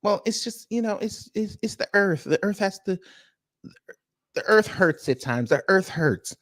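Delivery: high, halting explanation; put-on partner voice.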